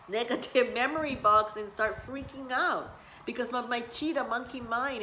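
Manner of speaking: ordinary talk, nothing marked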